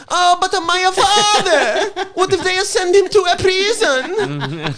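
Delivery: put-on high, loud, over-the-top Italian accent. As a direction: wail it slowly in a hammed-up Italian accent. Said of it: "doing a Saturday Night Live sketch of an Italian".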